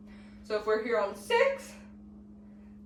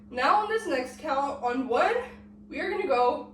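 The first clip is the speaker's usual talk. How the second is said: usual speaking voice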